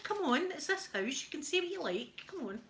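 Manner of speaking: high, wheedling retold plea; mock pleading, voice up; pleading cajoling. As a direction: coax her to loosen up, pitched high. Coaxing Alexa in retold argument.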